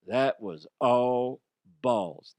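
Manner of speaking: slow, drawn-out commentator's call; sound-bite catchphrase. Shown as a demonstration. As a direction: stretch out each word for effect. Demonstrates the sound bite slowly.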